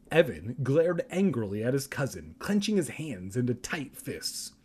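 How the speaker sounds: normal talking voice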